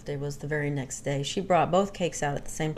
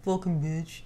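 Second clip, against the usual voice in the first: put-on meek kid; 2 semitones lower than usual. Child repeating curse to dad.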